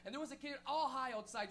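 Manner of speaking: ordinary delivery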